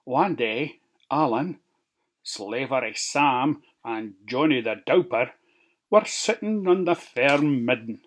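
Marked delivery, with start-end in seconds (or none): none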